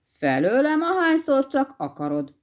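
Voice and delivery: ordinary delivery, nothing marked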